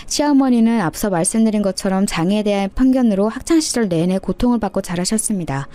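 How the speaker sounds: ordinary delivery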